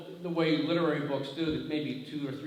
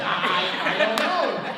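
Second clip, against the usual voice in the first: high, loud mock exasperation; flustered retort; 6 semitones higher, 9 dB louder than usual. Man stumped by a caller's guessing game, loudly flustered. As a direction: act out being stumped and fed up.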